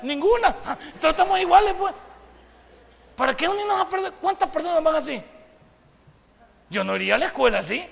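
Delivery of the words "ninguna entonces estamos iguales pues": laughing through the words, louder and higher. Real, not acted. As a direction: chuckle it out as the punchline lands. Laughing at the students' exchange. (riendo mientras lo dice)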